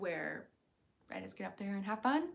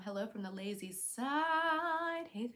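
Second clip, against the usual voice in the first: ordinary talk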